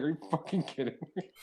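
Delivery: high, incredulous retold outburst; amused disbelief. Retelling reaction to friend deleting the video, incredulous.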